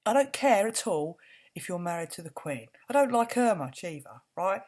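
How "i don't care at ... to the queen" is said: normal talking voice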